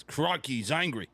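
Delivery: mock Aussie wildlife-host exclamation; attempted Australian accent. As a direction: put on a playful Australian accent. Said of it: "than I do Australian".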